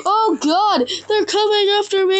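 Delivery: laughing shriek while being chased; high, frantic half-scream with laughter; panicked laughing. Real, not acted.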